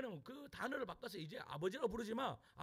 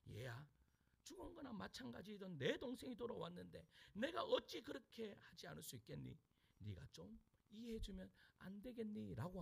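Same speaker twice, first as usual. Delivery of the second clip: tender father; 8 dB quieter than usual. Acted. Father gently pleads with elder son.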